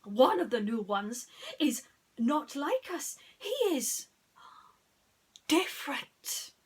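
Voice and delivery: loud, high, alarmed alien; startled alien. A narrator's reading. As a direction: point out the odd one in shock. Alien voice in the story.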